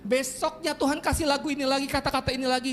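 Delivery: high-pitched, sustained sermon delivery; fervent, high-pitched preacher; impassioned preaching. Acted. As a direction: proclaim the testimony, slowing on quoted lyrics. Preaches with rising force.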